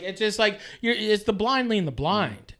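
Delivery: ordinary delivery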